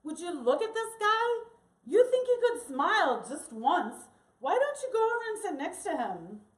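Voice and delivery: urging, high-pitched mischief; Ovidio. Retold or imitated. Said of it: "And Ovidio goes".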